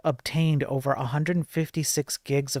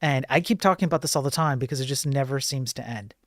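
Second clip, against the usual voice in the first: usual speaking voice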